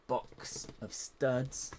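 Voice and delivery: box of studs stretched very slow in a strange put-on voice; odd drawn-out voice. Builder opening her tub, says box of studs in a slow silly voice. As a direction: draw out box of studs slowly, oddly. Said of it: "I don't know why I said that like that".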